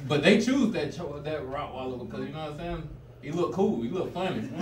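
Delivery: normal talking voice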